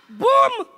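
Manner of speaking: loud, punchy bomb boom; mock explosion boom. Comic mimicking war news, a loud bomb boom. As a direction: burst out a big boom.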